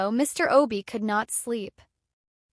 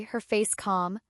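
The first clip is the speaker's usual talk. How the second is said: ordinary delivery, nothing marked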